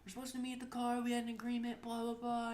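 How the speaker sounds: high-pitched mimicked scolding; mock screaming. Mom scolding her son for missing the car, mock-angry. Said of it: "they were screaming at each other".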